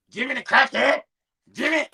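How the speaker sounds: high-pitched cry in a light impression; mimicking a crackhead friend, pitched right up; Jimmy. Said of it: "do the Jimmy impression".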